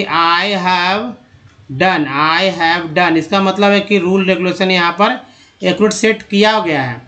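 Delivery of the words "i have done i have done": I have done drawn out very slowly; teacher's slow model sentence; slow demo phrase. Shown as a demonstration. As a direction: spell out I have done word by word. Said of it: "बोल रहे हैं कि".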